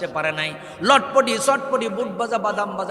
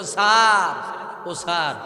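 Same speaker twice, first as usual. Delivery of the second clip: lightly voiced plea, long drawn-out first word; pleading son; 4 dB louder, much slower than usual. Son begging an officer over his dying father, mild plea. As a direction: stretch the call as if begging an official. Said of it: "ছেলেরা বলেছিল".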